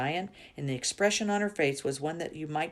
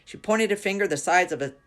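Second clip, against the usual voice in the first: plain reading voice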